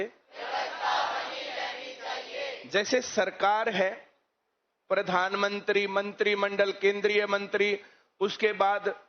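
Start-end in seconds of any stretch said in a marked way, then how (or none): 0.0-2.6 slow, soft whisper stressing व्यवस्था; slow hushed whisper as a rhetorical answer; whispered emphasis. Shown as a demonstration. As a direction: whisper it slowly, leaning on व्यवस्था, to drive the point home. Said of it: "क्या होना चाहिए?".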